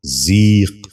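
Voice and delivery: ذیق with a long "ee", "zeeq"; correct pronunciation. Shown as a demonstration. Teacher giving viewers the model, correct pronunciation. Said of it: "دوبارہ سنیے".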